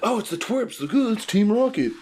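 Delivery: Team Rocket mock. Team Rocket bumping into the twerps, high cartoon surprise. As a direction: play the cartoon bumbling rivals. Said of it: "And they're like".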